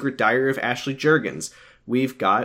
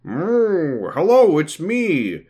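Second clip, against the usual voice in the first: higher, slow cow greeting; 6 semitones higher, 3 dB louder, much slower than usual. Acted. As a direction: drawl it like a cartoon cow. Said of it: "Like that".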